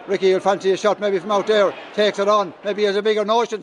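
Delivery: ordinary talk, nothing marked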